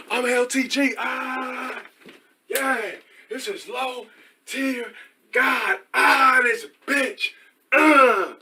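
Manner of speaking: very high, strained parody shouting; mock attention-seeker. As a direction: wail a hammed-up stream persona. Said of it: "just walking around shouting shit".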